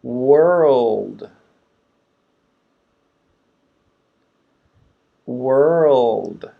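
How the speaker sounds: slow drawn-out World. Shown as a demonstration. Teacher modeling the whole word slowly. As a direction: say World slowly, each sound clear. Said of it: "Repeat after me".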